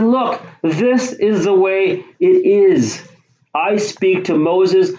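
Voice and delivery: God putting them in their place, slowly and sternly. Retold or imitated. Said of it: "He said".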